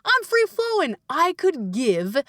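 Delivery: high, bubbly and carefree; high, breezy confidence; filled-up parent. Retold or imitated. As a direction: sing out like someone overflowing with energy. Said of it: "We're like, Baby".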